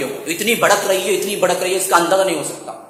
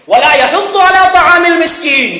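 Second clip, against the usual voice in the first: chanted recitation, melodic and raised; Quranic recitation; 7 semitones higher, 4 dB louder than usual. Acted. Preacher reciting the verse to the congregation, chanted. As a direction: intone the verse with tajweed.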